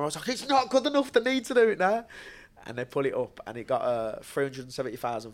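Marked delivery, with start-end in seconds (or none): 0.3-2.0 high-pitched, worked-up protest; mock-exasperated, pitch jumping right up; retold impatience. Fighter to his missus about the unposted clip, high impatient complaint.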